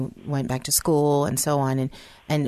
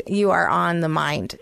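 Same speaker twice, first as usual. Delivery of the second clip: usual speaking voice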